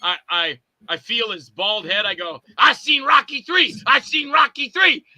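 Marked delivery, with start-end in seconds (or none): 2.6-5.0 high, loud battle cry; fighting yell. Acted. Puny fighter yells at the big bald monster guy, fighting yell. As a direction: yell it twice like a cocky brawler. Acted fight boast, yelling.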